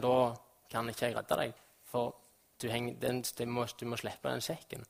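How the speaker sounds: low, very soft, sorrowful refusal; acted sorrow, hushed and low. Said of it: "det er sorg i den stemmen".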